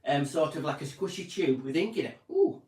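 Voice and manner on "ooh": delighted ooh. Pretend. Savouring a biro's ink.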